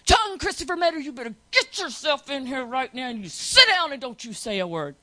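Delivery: stern mama calling her son in; angry parent shouting the full name; yelling child's full name. Retold or imitated. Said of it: "Call 'em by their all three names".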